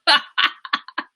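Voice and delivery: high, delighted cackle; laughing. Real, not acted. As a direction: burst out laughing. Laughs with Jana.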